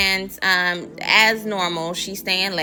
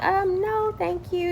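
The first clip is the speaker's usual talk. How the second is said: high, soft mock-polite decline; sweetly decline, pitched way up; polite refusal; 12 semitones higher, 4 dB quieter than usual. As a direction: imitate a dainty brush-off. Imagined polite refusal to boss.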